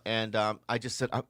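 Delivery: normal talking voice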